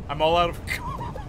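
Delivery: laughing through the line; can barely get the words out for giggling. Real, not acted. Repeating the quote, cracking up.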